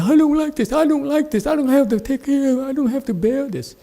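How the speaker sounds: high, mocking whine; whiny girl imitation. Master mocks the student's whining in a high whiny voice. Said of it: "you whine like a girl".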